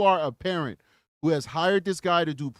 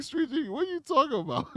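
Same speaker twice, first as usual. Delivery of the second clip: mock chat voice; 11 semitones higher than usual. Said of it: "They're like".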